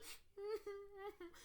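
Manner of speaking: high, soft imitated whimpering cry; mock crying whimper. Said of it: "He's like".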